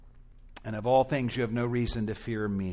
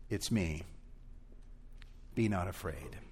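soft, quiet and calm; gentle reassurance; 5 semitones lower, 9 dB quieter than usual. Acted. Jesus calming frightened disciples, soft and tender. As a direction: say it simply and tenderly. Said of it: "all he says to them is".

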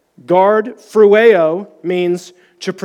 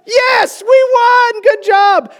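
much higher, loud cheering shout; victorious yell; triumphant cheer; 4 dB louder, slower than usual. Retold or imitated. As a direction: cheer like your team just won. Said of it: "I'm like".

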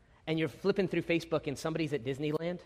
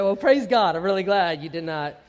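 praise God; 8 dB louder than usual. Retold or imitated. Pastor's retold reply.

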